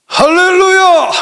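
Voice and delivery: high, slow, stretched cry of praise; a pastor crying out to the church in joy; ecstatic hallelujah. Real, not acted. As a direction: lift the whole room with it. Preacher calls out to congregation.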